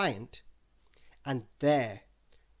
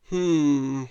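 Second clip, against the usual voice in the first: retorting hmm; 9 dB louder than usual. Acted. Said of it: "retorted the wizard".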